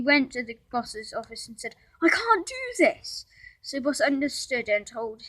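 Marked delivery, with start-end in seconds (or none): 2.0-3.2 despairing plea. A narrator's reading. Josh giving up to boss.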